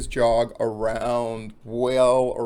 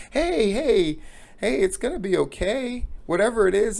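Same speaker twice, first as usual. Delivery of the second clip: higher, louder consoling voice; acted reassurance; 9 semitones higher, 4 dB louder than usual. Jogger comforting a sobbing woman, high insistent reassurance. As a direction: coax her out of crying, pitched well up. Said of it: "puts his arm around her".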